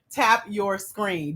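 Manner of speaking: usual speaking voice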